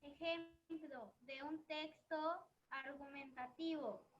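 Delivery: far from the mic, echoing; speaking from across the room; echoey and distant. Real, not acted. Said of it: "se te escucha como, como eco".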